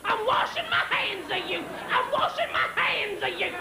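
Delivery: high, drawn-out mock scream; imitated screaming. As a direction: screech it in exasperation, same rhythm each time. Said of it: "He's screaming".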